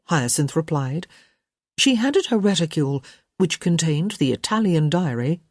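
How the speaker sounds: ordinary delivery, nothing marked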